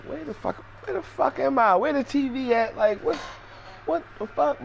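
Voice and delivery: very high, hushed panic; a very high, soft, frantic voice; panicked. Retold or imitated.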